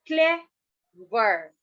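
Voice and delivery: "clever" in two drawn-out parts with a gap between; broken into syllables. Shown as a demonstration. Teacher proving two syllables, "clever" pulled apart. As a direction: break "clever" into cle, pause, ver. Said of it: "break the word in two".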